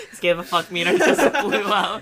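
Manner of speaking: words squeezed out through laughter; laughing. Real, not acted. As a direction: talk while cracking up. Cracking up at friend's remark.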